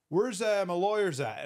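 imitated call-out. Someone backstage looking for the lawyers, imitated call-out.

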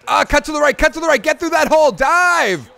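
high, loud and fast shouted commands; excited urging. Real, not acted. Gamer shouting at his running back to cut, in fast excited yells. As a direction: yell the runner through the hole. Urging runner near goal line.

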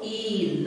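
ई held long as eee; long drawn-out ई. Shown as a demonstration. Teacher showing दीर्घ ई की मात्रा, drawn-out vowel. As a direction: stretch the vowel ई slowly. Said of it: "खींचना है".

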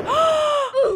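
very high, squealing gasp; horrified gasp. Pretend. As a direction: gasp aloud in mock horror. Watcher reacts to explosion.

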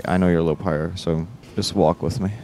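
usual speaking voice

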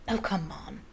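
mild impatience, under the breath; a touch of exasperated coaxing; impatient. A narrator's reading. Boy presses his friend to be his date, impatiently. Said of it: "he said impatiently".

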